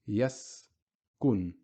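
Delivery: "Yaskun" with a short bare s before the k; Arabic word demo. Shown as a demonstration. Teacher shows sukun on the s for students, deliberate. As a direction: clip the s in "Yaskun", no vowel. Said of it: "We're just saying s".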